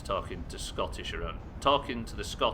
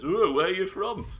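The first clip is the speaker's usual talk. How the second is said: high, loud mock questioner; mock clueless joker; 10 semitones higher, 8 dB louder than usual. Somebody reacting to an attempted accent, mock curiosity. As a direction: put on a loud, high-pitched silly query. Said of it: "somebody says".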